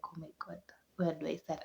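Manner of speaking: hushed, near-whispered helpless aside; overwhelmed hush. Retold or imitated. New mother at a loss over her crying baby, hushed and overwhelmed. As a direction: let it drop to a breathless hush, lost for answers.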